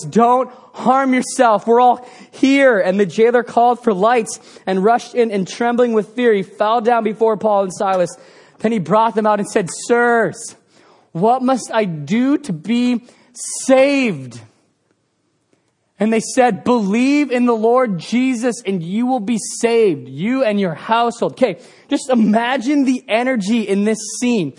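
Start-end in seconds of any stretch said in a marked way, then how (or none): 0.0-2.8 loud cry. A narrator's reading. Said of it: "Paul cried with a loud voice". 2.8-21.3 raised, earnest Bible reading; scripture reading. Acted. Pastor reading the Bible passage to the congregation, raised and earnest.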